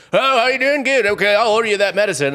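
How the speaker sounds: high, loud, offhand doctor voice; breezy doctor. Retold or imitated. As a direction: toss it off like a doctor in a hurry. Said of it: "the doctor just comes in and is like".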